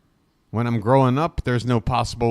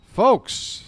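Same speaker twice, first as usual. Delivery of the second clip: calling out; 10 semitones higher than usual. Real, not acted. Calls out to viewers on donation.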